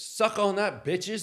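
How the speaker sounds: loud gloating jeer; hammed-up drunk gloat; mock taunt. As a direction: crow it over the table. Drunk friend taunting at poker.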